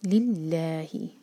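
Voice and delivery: लिल्लाही with doubled ल, slow Quranic recitation; recited slowly. Shown as a demonstration. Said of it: "इसको इसी तरह लिखा जाता है". (लिल्लाही धीरे, तजवीद के साथ)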